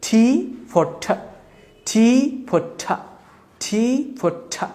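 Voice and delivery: টি for ঠ, very slow and spelled out; slow drill. Shown as a demonstration. Teacher drilling the aspirated t very slowly. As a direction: drag each sound out like a dictation. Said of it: "উচ্চারণ হয়ে হচ্ছে ঠ".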